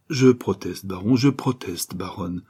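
ordinary delivery

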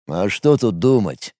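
low, slow, gloomy rumble; gloomy bass voice. A narrator's reading. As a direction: grumble it low and grim. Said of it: "угрюмо пробасил Мареш".

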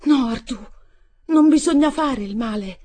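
high, loud pleading cry; a desperate loud plea. Acted. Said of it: "Gridò".

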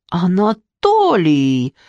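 drawn-out slow cry of the name; a wife calling her husband for help; exclaiming. Acted. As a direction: cry out the name, hand on heart. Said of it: "воскликнула дама".

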